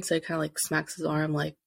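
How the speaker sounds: normal talking voice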